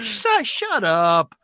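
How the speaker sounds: slow, drawn-out shut up; wife telling husband to hush, exasperated; mock exasperated. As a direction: stretch it out in disbelief. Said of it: "She's like".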